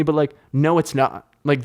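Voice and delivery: normal talking voice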